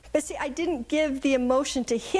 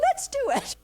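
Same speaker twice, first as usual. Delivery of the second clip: much higher, bright eager voice; excited cheer; 13 semitones higher than usual. Retold or imitated. Grandmother cheering her granddaughter toward the iPad, excited. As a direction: pitch it way up with glee.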